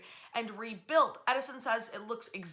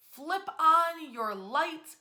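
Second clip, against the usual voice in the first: slow, high, louder broadcaster command; announcer's call; 6 semitones higher, 6 dB louder, much slower than usual. Retold or imitated. Broadcasters instructing America.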